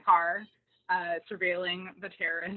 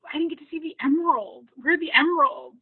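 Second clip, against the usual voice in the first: eager Parker; 6 semitones higher, 8 dB louder than usual. Retold or imitated. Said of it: "Parker's like".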